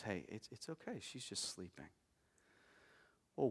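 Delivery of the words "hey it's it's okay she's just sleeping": much softer, tender hush; like calming someone at a bedside; gentle reassurance. Retold or imitated. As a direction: soothe the grieving parents quietly. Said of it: "seem so offensive and insensitive".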